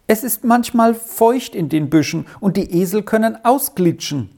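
put-on high-pitched boy, mildly pleading; stubborn young boy, voiced high; reluctant boy.

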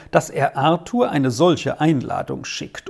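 ordinary delivery, nothing marked

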